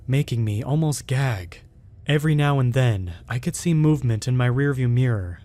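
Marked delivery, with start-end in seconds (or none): none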